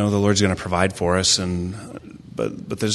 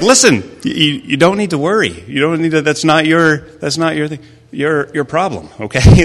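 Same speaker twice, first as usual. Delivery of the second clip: raised, higher voice, insistent mock scolding; exasperated parent; 8 semitones higher, 8 dB louder than usual. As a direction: reenact a dad firmly telling his kid off. Retells exasperated talk to worried son.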